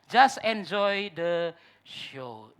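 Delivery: "Just enjoy the show" stretched very slow; slow, weighty "Just enjoy the show"; drawn-out slogan. Real, not acted. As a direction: spell out "Just enjoy the show" word by word, lingering on "show".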